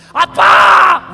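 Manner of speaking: high, sudden loud shout; demonstrating a fervent prayer cry. Shown as a demonstration. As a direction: call God as Daddy at full voice. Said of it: "부르짖는 거 많이 봤어요".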